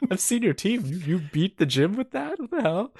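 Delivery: high, laughing disbelief; incredulous. Retold or imitated. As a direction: squeak it out in disbelief.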